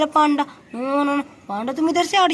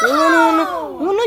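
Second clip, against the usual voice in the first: usual speaking voice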